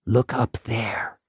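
breathy whisper. Acted. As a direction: whisper it like a secret. Janitor repeats, whispered.

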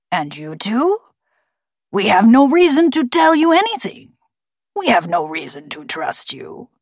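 forced bird voice, swooping in pitch; distrustful talking bird. A narrator's reading. Bird refusing to trust the intruders, forced gravelly voice. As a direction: lay down the terms as a scornful bird.

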